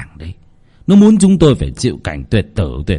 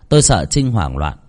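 plain reading voice

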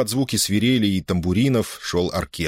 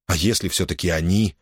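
ordinary delivery, nothing marked

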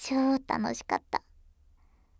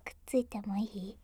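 pillow talk; 9 dB quieter than usual. Acted.

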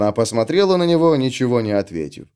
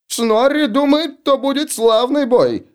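very high, slow dopey voice; simple-minded dwarf voice; 13 semitones higher than usual. Acted. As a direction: play Snorri high and slow. Snorri speaks in the story.

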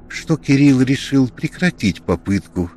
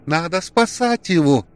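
higher urgent plea; urgent female cry; 8 semitones higher than usual. A narrator's reading. Alena demanding Kirill save friend, urgent higher cry. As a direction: push him to act now.

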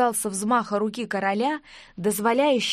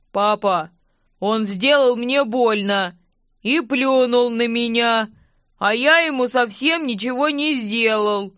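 a big brute whining to his dad; whining giant; 5 dB louder than usual. A narrator's reading. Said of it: "густым басом проныл великан".

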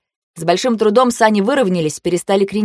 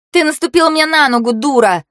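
high-pitched, heated complaint; angry squabbling; 7 semitones higher, 3 dB louder than usual. A narrator's reading. Girl scolding rival over trodden foot, angry. Voiced squabble on crowded sled.

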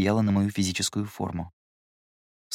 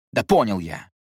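irritated. Acted. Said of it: "с раздражением бросил Гедеон".

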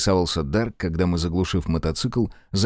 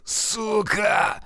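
pained cursing; 12 semitones higher, much slower than usual. Acted. Shot man curses in pain.

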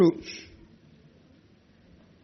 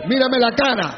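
commanding; 4 semitones higher, 3 dB louder than usual. Acted. Confronting the listener.

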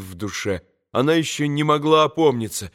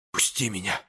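soft, hushed plea, much softer; whispered plea; 6 semitones lower, 9 dB quieter than usual. A narrator's reading. Katya to Mitya, a whispered plea to let her go. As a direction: beg to be let go under her breath. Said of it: "прошептала она".

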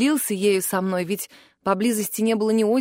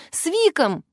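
high urgent call-back; 7 semitones higher, slower than usual. A narrator's reading. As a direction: call the answer through a door, pitched up. Shouting answer through locked door. (взволнованный возглас через дверь)

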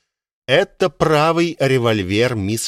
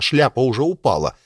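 ordinary delivery, nothing marked

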